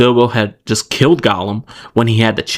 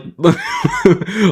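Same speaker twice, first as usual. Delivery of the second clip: high laugh. Real, not acted. Laughing mid-sentence.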